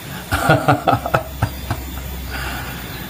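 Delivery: mocking laugh. Real, not acted. Mocking the alleged recording.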